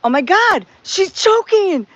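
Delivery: panicked nurse; retold alarm. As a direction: raise the alarm about the choking.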